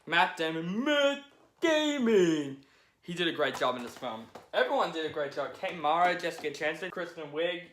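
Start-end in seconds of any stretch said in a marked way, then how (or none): 0.8-2.6 much higher, slow, stretched-out name; excited shout-out. Shown as a demonstration. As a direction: hype the name like an announcer. Name said twice, second drawn out.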